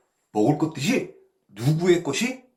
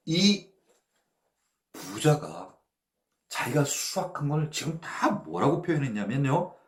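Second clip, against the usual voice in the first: normal talking voice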